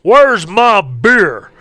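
high yelp then a loud, slow, drawn-out demand; lazy husband bellowing. Retold or imitated. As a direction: bark it at the wife like a couch king. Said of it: "I can just see all these guys now".

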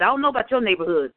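ordinary talk, nothing marked

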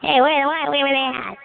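imitating a high squeaky voice. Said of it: "that squeaky voice".

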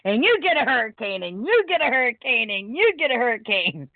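mock game-show giveaway announcer, sing-song; guy above Florida. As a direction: hand out each one with a punch on YOU. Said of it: "picturing this guy above Florida going".

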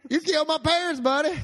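high, loud Southern twang; [name] voice. Pretend. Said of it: "It's the buddy".

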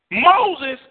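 high, drawn-out preached line; preacher's sung-out declaration; high slow proclamation. Real, not acted. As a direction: stretch it out high for the whole room.

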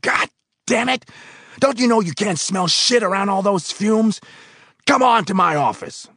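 high, loud roar. Acted. Foreman roaring in disbelief at the worker. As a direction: roar the word back at him.

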